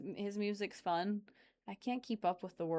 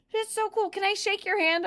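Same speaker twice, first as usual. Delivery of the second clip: put-on much higher excited child voice; kid's voice; 13 semitones higher, 6 dB louder than usual. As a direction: squeal it like a starstruck kid. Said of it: "He was just at my work".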